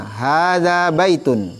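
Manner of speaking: slow model reading, Hadza baitun with the ha drawn out; teacher modelling a long ha in Hadza. Shown as a demonstration. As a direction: stretch the ha in Hadza. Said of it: "Panjangkan ha-nya".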